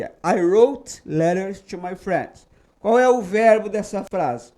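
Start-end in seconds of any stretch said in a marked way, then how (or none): 0.0-2.5 slow model sentence. Shown as a demonstration. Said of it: "Exemplo aqui".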